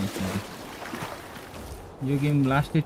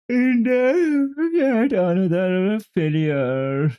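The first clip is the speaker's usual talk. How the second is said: mock wail; 9 semitones higher, 4 dB louder, much slower than usual. Reading a failed-experiment memo aloud.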